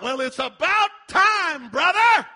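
high, drawn-out preacher's yell; exasperated cry from the pulpit; shouted retort. Acted.

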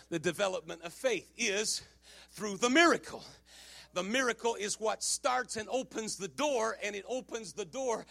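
hushed, measured preaching with pauses; ingetogen prediking. Acted. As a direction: bring it right down and let it sink in. Drops to hushed teaching after climax.

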